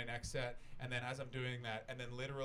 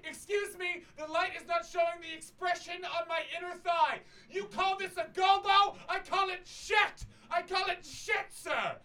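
very high, loud, drawn-out indignation; hammed-up artiste berating the venue staff; outraged diva dancer; 11 dB louder than usual. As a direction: shriek it like an affronted diva. Mimics indignant dance troupe diva.

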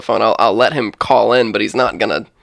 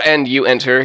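ordinary talk

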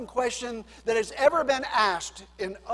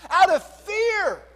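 forceful preaching; 9 semitones higher, 9 dB louder, slower than usual. Acted. Preaching climax.

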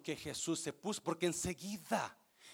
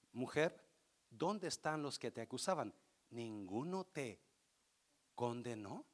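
softer, lower, gentle question; tender Jesus voice; 7 semitones lower, 8 dB quieter than usual. Acted. Jesus gently asks the woman if anyone condemned her, tender. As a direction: ask her softly, with compassion.

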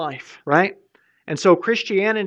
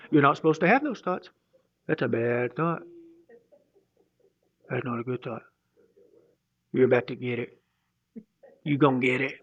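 menacing Holy Spirit; 4 semitones lower, 4 dB quieter than usual. Pretend.

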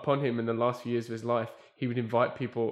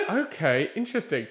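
bemused surprise; 5 semitones higher, 6 dB louder than usual. Retold or imitated. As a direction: sound baffled but intrigued. Producer's bemused reaction.

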